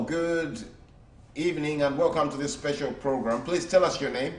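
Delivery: usual speaking voice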